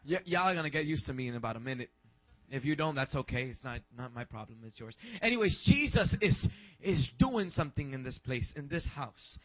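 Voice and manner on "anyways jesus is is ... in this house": loud, rousing preacher voice; preacher proclaiming to the church; full-force preaching. Acted. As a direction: lift the congregation with praise. Preaching to congregation.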